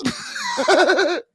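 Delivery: high-pitched laughing fit. Real, not acted. Streamer cracking up at his own accent bit, high laugh.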